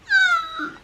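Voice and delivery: a high, squeaky pained laugh. Real, not acted. Vlogger reacting to a creepy movie scene, pained high laugh.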